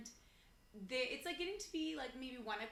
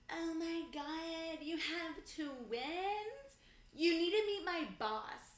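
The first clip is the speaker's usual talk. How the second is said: put-on slow, stretched-out drunk woman, pitched high; imitating slurring drunk; 5 semitones higher, much slower than usual. Drunk wife gushing to a mom of twins, mock slurred. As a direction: drag the words out like a hammered stranger. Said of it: "could not formulate words".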